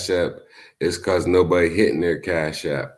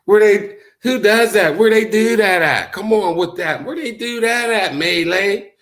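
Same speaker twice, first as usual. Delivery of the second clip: high, loud incredulous complaining; mock indignation; 11 semitones higher, 4 dB louder than usual. Imitating a caught-out viewer protesting to Melee, mock indignant. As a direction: act shocked and offended, overdone. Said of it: "When they say".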